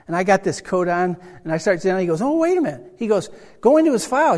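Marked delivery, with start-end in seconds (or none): 2.2-4.4 high-pitched sudden "oh, wait"; retold eager caretaker cutting in; excited interruption. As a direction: jump in high with a new idea. Caretaker excitedly stops him.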